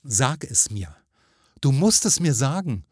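urgent, rising insistence; demanding. A narrator's reading. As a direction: press hard for an answer.

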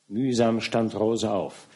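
ordinary delivery, nothing marked

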